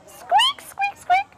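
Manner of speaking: very high-pitched little squeaks; mouse squeaking. Shown as a demonstration. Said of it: "you're gonna say it in mouse".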